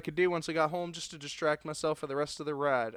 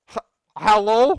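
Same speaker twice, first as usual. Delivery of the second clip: stammered, raised questioning hello; calling out; 5 semitones higher, 11 dB louder than usual. Acted. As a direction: call out loudly from a stuck elevator. Trapped character calls for someone.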